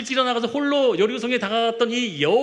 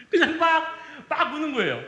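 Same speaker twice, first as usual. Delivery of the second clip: laughing; 7 semitones higher than usual. Real, not acted. Laughing while telling story.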